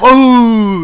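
high loud teasing whoa; mock shocked whoa. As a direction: students reacting to a teacher saying crap. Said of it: "they would go".

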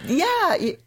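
ordinary talk, nothing marked

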